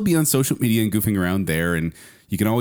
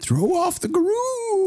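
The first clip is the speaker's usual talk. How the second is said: high sing-song; much slower than usual. Pretend. Mock groove line, very high.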